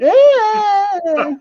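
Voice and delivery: high, loud yelp; mock high-pitched wail of alarm; imitated panicked cry. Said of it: "in a state of panic".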